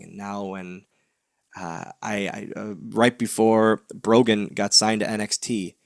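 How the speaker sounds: normal talking voice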